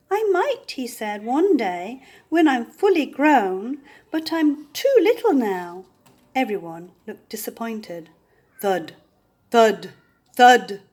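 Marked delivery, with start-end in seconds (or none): 0.1-5.8 small creature voice. A narrator's reading. Small beast answers timidly.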